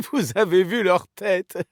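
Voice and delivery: high, giggly amusement; laughing. Acted. Gloating over the enemies' faces, laughing. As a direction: talk through a laugh you can't hold back. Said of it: "je ne devrais pas rire".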